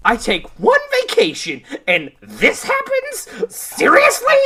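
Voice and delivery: high, loud exasperation, climbing at the end; incredulous complaint. Acted. Velma sees the city in chaos on vacation, exasperated. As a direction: squeal it in disbelief.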